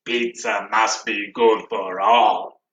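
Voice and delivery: low slow monster voice; Cookie Monster voice. Shown as a demonstration. Last chant line for class, low Cookie Monster voice. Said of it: "that Cookie Monster voice at the end".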